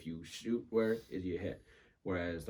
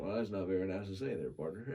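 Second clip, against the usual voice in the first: put-on Western cowboy accent; faster than usual. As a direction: put on an Old West accent, amused. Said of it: "West and be like".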